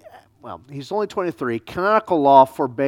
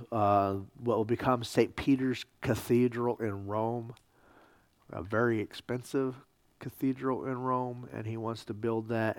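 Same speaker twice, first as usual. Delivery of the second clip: normal talking voice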